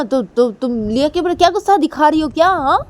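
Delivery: loud, high angry scolding. Acted. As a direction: scold her sharply for taking it out on the girl. Angry mother scolding Ruhi. (गुस्से में डाँटना)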